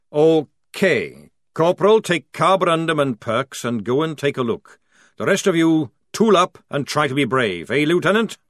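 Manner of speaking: loud barking sergeant issuing orders; gruff sergeant. Acted. Jackram orders the squad to arm up, gruff and loud. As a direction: take command of the squad.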